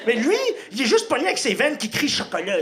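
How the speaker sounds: ordinary delivery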